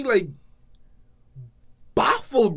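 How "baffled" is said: ordinary delivery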